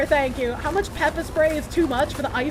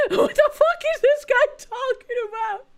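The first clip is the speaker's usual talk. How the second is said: laughing; 9 semitones higher than usual. Real, not acted. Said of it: "I almost said a slur".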